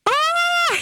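sudden high squeal of joy; excited squeal. Real, not acted. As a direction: let out a thrilled shriek. Thrilled to announce the move.

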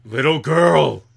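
loud, gruff monster call; booming call. Acted. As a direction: bellow at the little girl. GM voices a monster calling out.

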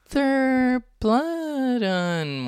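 very slow, high, stretched out; high drawn-out question. Acted. General asks very slowly, high.

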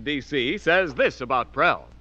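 normal talking voice

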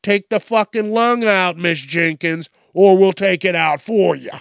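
high, loud threatening order; bully her into giving the lung up; loud mock repo man threat; menacing demand. Repo man threatens Miss Jenkins.

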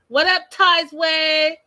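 high, loud, drawn-out greeting; calling out. Real, not acted. Host hailing a viewer in the chat, calling out. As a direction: holler a slow hello.

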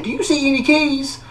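high, exasperated demand; indignant challenge. Retold or imitated. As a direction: prove your point, fed up. Arguing with nurse, emptying pockets.

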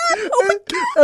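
high squealing laugh-cry; laughing shriek. Real, not acted. Reacting to a joke about Fluffy.

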